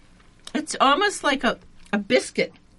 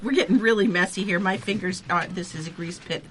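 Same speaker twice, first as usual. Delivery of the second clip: ordinary delivery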